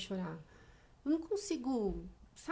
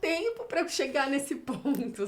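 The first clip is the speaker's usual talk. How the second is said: laughing; 6 semitones higher, 7 dB louder than usual. Real, not acted. Laughing at her own retelling.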